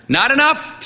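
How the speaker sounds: high, sharp, curt; snapped. A narrator's reading. Old priest snapping at the boy's excuse. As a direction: cut him off sharply. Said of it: "snapped the priest".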